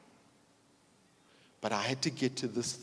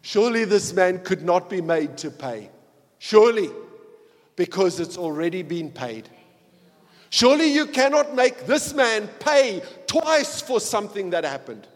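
loud, rising preacher's cry of surely; preaching at full force; 5 semitones higher, 15 dB louder than usual. Acted. Preacher pleading the advocate's case to the judge, loud and full. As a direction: preach it out to the whole room. Passionate climax of the sermon.

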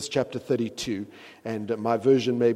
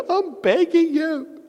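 high, slow, far-off pleading whine; hammed-up plea; mock begging; 9 dB louder, slower than usual. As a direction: beg with a laugh.

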